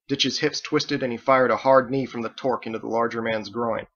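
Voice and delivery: ordinary delivery, nothing marked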